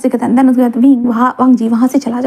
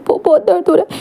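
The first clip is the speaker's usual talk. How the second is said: in pain; 6 semitones higher than usual. Acted. Wounded Wing in pain.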